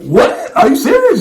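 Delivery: high-pitched mock shock; incredulous squeak, replaying the reaction; retold disbelief. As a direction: act out a stunned double-take, voice shooting up. Retold shocked reaction to brother's news.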